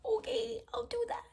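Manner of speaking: very high starstruck reply; high flustered fangirl voice; squeaky eager okay. Retold or imitated. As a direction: squeak it out like a nervous fan. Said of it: "And I was like".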